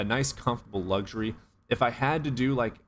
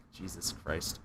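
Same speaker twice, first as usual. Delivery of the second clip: exasperated mutter; 3 semitones lower, 10 dB quieter, much slower than usual. Real, not acted. Exasperated at macho men.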